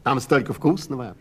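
loud puppy voice barking out the words. Acted. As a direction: bark it like an eager puppy. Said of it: "сказал щенок тявка". (громкий щенячий лай)

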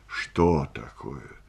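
low, slow, wary question; understated start, drawn out; startled question. A narrator's reading. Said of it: "вздрогнув".

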